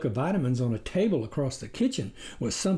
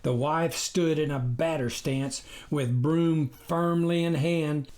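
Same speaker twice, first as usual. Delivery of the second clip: ordinary delivery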